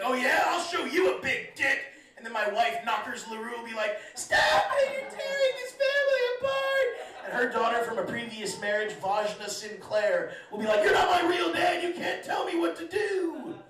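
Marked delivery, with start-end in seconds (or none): 0.0-1.8 hammed-up yell, higher and louder; mock shout. Dad yelling back at his taunting son, mock shout. 4.1-6.9 shrieking, much higher and louder wife voice; shrill wife. Acted. Knockers LaRue begging dad and son to stop, high shriek. 10.8-13.6 higher, louder stepdaughter shouting; bratty teen yell. Acted. Stepdaughter screaming at her new dad, bratty yell.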